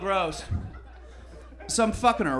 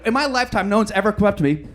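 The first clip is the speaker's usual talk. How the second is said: usual speaking voice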